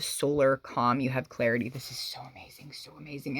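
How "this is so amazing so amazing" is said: breathy near whisper; soft whispered amazement; hushed awe. Real, not acted. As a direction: marvel at it under your breath. Hushed awe over reading.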